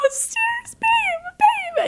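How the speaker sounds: high sing-song babe; coaxing high call. Retold or imitated. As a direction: call him sweetly in a high voice. Calling out to sleeping boyfriend.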